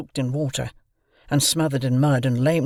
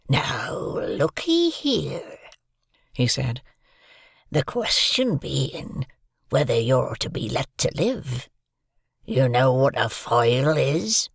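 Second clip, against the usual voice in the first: slow, hoarse menacing whisper; threatening convict whisper; slower than usual. A narrator's reading. Convict threatening to eat the boy, slow menacing whisper.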